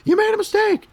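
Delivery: high mock student calling out a mistake; imitating a student. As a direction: pipe up like a kid pointing out the mistake. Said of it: "and be like".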